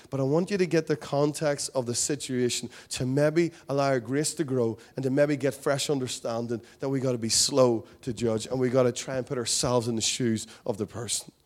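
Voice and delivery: ordinary talk, nothing marked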